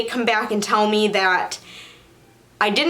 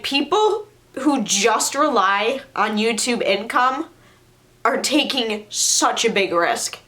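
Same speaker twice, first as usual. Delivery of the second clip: ordinary delivery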